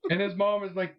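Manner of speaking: normal talking voice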